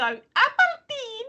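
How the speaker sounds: high, drawn-out Scottish ABERDEEN; Scottish accent. Shown as a demonstration. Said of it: "only way I can do a Scottish accent".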